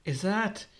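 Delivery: a touch of astonishment, voice lifted; mild gasp. A narrator's reading. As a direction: ask it as a light gasp. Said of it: "Luffy gasps".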